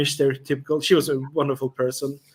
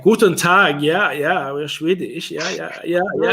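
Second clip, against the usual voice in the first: mock German accent; 4 semitones higher, slower than usual. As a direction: ham up a movie German accent. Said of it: "we always sound like German for some reason".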